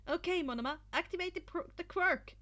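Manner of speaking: eager command. A narrator's reading. Izuku ordering Monoma to activate the quirk. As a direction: give the signal. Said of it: "Izuku said".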